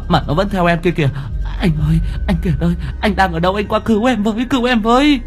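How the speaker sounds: very high, panicked pleading woman; frantic insistence. A narrator's reading. Girlfriend begs her boyfriend to rescue her from a ghost, frantically. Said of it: "Giọng của My khẳng định chắc nịch".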